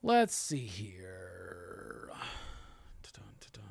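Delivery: low, slow, drawn-out mumble; very soft, low filler to oneself; idle murmur. Real, not acted. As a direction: trail into a hushed ta-dum while searching. Idle murmuring while searching.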